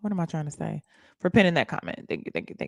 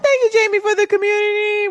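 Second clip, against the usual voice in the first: very high, loud shout-out; excited thanks; 13 semitones higher, 4 dB louder than usual. Real, not acted. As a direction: sing out a thank-you to a gifter. Shouting out a gift.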